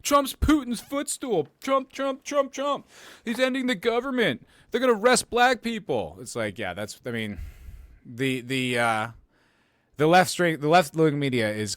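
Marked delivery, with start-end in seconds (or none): 0.0-6.2 much higher, louder mock panic; hammed-up mock hysteria, high and loud; mocking alarmist voice. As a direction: parody the fear-mongering media, pitched way up. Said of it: "And now it's just like".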